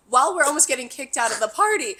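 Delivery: ordinary delivery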